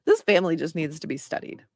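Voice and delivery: ordinary talk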